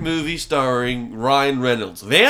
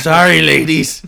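creepy van guy; 2 semitones higher, 7 dB louder than usual. Acted.

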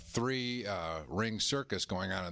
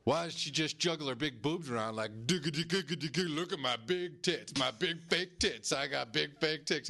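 higher, sing-song mocking voice; mocking jeer; 6 semitones higher than usual. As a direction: tease her like a juvenile heckler. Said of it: "he goes".

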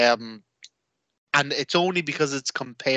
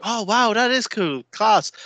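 mock impressed girlfriend; 7 semitones higher than usual. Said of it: "And she'd be like".

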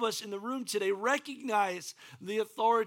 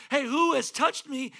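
calling out; 5 semitones higher, 5 dB louder than usual. Acted. Jesus calling out in the crowd.

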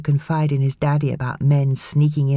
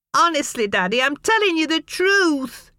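sobbing. A narrator's reading. Boy sobbing to his father, high and tearful. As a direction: plead through sobs. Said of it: "Casey sobbed".

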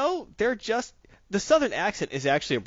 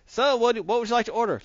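lightly voiced waiter, pitched up; a higher, louder hint of a server's voice; mock waiter; 10 semitones higher, 4 dB louder than usual. Waiter asks a customer for an order, lightly mimicked.

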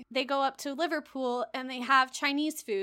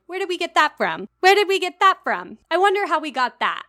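loud, higher mock-innocent sarcasm; sarcastic American retort; 7 semitones higher, 11 dB louder than usual. American answering British critics, loud mock-innocent sarcasm. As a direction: feign innocence, dripping sarcasm. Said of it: "an American will cut in and be like".

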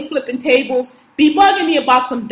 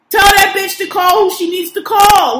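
furious outburst; 7 semitones higher, 6 dB louder than usual. Real, not acted. Fed-up rant about coworker.

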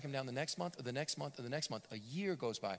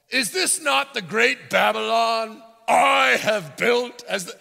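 very slow, high, shouted royal boast; hammed-up proud emperor surveying Babylon; pompous king; 9 semitones higher, 17 dB louder, slower than usual. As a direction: proclaim like a vain cartoon king. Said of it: "who talks like this?".

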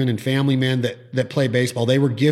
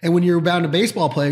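ordinary delivery

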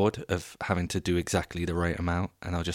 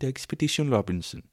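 higher, sing-song Swedish "Expedition Robinson"; playful Swedish accent, pitched up; 6 semitones higher than usual. Shown as a demonstration. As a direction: try again in a lilting Swedish accent. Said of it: "Is that the Swedish accent?".

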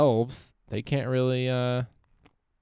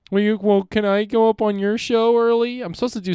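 much higher and louder, a whiny pleading voice; mock begging comic; 11 semitones higher, 8 dB louder than usual. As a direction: play a needy open-mic comic begging for an early spot. Mimicking comics begging for stage time.

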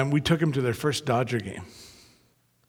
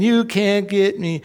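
mocking child; 5 semitones higher, slower than usual. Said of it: "the boy was mocking the dad".

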